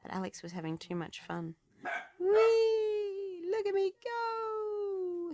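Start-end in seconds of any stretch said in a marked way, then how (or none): 2.2-5.3 high, thrilled shout, slowing on the end; excited shout. A narrator's reading. Alex racing downhill, whooping with glee. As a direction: squeal it out with joy. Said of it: "he shouted".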